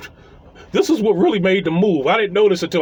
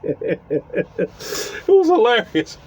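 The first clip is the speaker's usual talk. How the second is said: laughing; much slower than usual. Real, not acted. Laughing through the punchline.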